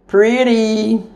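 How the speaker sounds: high, loud cooing over the food; bursting with pride; delighted squeal. Real, not acted. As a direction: gush at how pretty it looks. Admiring her cornbread muffins.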